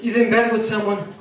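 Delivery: normal talking voice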